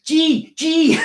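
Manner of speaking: high, loud mock Punjabi yes; comic, high-pitched eager agreement; imitating friend's dad. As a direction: pipe up loudly as if you understood. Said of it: "he'll just, he'll go".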